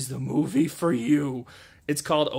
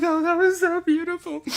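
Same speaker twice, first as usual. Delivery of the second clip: very high, loud, hammed-up crying wail; hammed-up blubbering over a beautiful ending; mock sobbing; 6 dB louder than usual. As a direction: wail it through fake tears. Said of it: "I was like".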